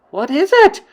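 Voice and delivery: high, loud alarmed question; witches crying out. Acted. Witches answering the shrieking witch, alarmed. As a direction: call back in alarm. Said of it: "the others cried out".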